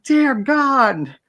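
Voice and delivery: very high, appalled gasp; horrified. Retold or imitated. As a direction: squeak it in horror. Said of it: "He's like".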